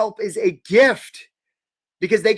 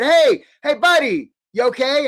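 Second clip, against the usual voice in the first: higher and louder, calling to someone face down in water; 7 semitones higher, 6 dB louder than usual. Retold or imitated. Rescuer calling out loudly to a person floating face down. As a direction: shout over the water to a still body.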